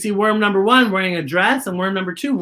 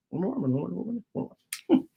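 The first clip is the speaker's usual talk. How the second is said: quick and much softer, stumbling; fast tongue twister, soft; 10 dB quieter, much faster than usual. Shown as a demonstration. Reader retrying the twister fast and softer. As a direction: mumble Worm and Worm on the wonderful wedding day fast. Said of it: "Say that five times fast".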